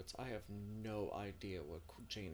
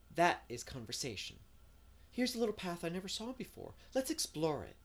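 higher, lively storybook heroine; eager, dreamy Anne; 9 semitones higher, 8 dB louder than usual. Acted. Anne planning a birthday outing in the spring, eager and dreamy. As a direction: voice an enthusiastic dreamer, pitched up.

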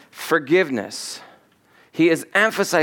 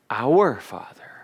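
slow hushed whisper; 4 semitones lower, slower than usual. Shown as a demonstration. Preacher contrasting your and our for the listeners, slow whisper. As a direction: whisper it slowly, tenderly. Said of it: "It's a relational prayer".

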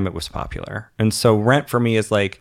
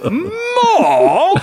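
shrieking, outraged high-pitched "More?"; booming shocked master; 6 dB louder than usual. Acted. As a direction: bellow it back in shocked outrage. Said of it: "And then I'll go".

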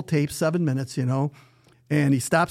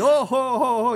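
mock belly laugh, pitched up; imitating a hearty laugh; 9 semitones higher than usual. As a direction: ham up the boss cracking up at his own joke. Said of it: "he's laughing like crazy".

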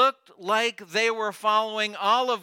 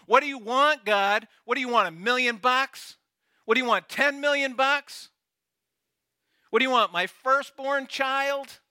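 higher and louder, mocking exasperation; sarcastic exaggeration; 7 semitones higher, 3 dB louder than usual. Judah mocking God's demands with sarcastic exaggeration. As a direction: fling each offer up in sarcastic disbelief. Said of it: "exaggeration, arrogance, sarcasm".

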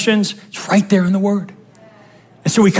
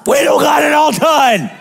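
mock frantic; 4 semitones higher than usual. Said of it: "be like".

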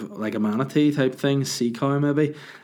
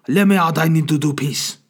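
put-on loud, higher Chinese-accented dog yell; mock Chinese accent, shouting; 6 semitones higher, 8 dB louder than usual. Dog begging owner to let him out, shouted mock Chinese accent. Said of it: "This is the way I talk".

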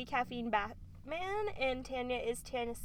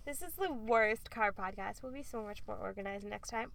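ordinary talk, nothing marked

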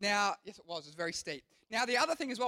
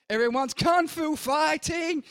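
frantic excited; 6 semitones higher, 6 dB louder, slower than usual. Retold or imitated. Frantic kicking for the brake.

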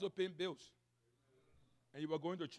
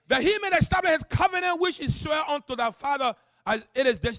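loud, high, declamatory pulpit voice; full-force preaching; 8 semitones higher, 17 dB louder, slower than usual. Real, not acted. Pastor reading scripture and mocking self-reliance, full-force preaching. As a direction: proclaim it with ringing authority.

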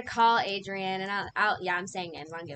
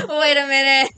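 loud, higher, cracking up; laughing; 4 semitones higher, 13 dB louder than usual. Real, not acted. Host retells her shocked reaction to friend's confession, laughing. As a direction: burst out laughing through the words.

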